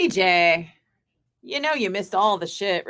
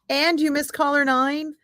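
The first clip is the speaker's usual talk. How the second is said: mock pity; 6 semitones higher than usual. Mocking sympathy continues.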